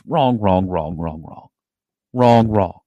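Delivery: loud, insistent correction; emphatic wrong. Real, not acted. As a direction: shout it down, over and over. Rejecting the wrong title.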